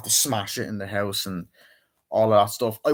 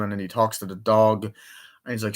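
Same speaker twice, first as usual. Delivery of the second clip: ordinary talk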